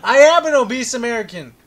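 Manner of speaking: mock indignant declaration. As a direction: proclaim it proudly loud, half joking. Mock protest after fat joke.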